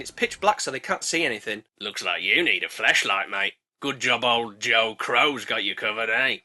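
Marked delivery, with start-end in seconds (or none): 1.8-6.4 low, geezer-ish crow voice; a shifty car-park hustler crow; lowered wide-boy crow; dodgy crow. Acted. Crow selling flashlight.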